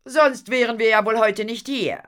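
high, loud, pointed retort; cool. A narrator's reading. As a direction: put him down coolly. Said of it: "erklärte Madame Fatal kühl". (kühl, aber laut und spitz)

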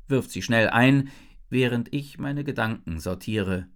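plain reading voice

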